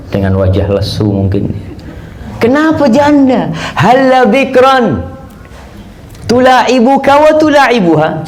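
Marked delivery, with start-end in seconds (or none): 2.4-8.3 high, slow, drawn-out asking; Prophet's gentle question. Acted. Prophet asking Jabir why he married a widow, high and slow. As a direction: lift the question up and let it linger.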